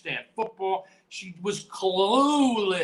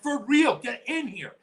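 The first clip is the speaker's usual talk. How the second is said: a much higher, louder shout for help; urgent call; 8 semitones higher, 4 dB louder than usual. Retold or imitated. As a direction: yell it at the medics, urgent. Referee calling the EMTs urgently.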